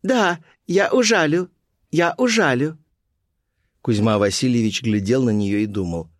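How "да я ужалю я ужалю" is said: Colibri's voice. A narrator's reading. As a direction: draw it out high, repeating playfully. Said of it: "залилась тонким хохотом и несколько раз сряду повторила".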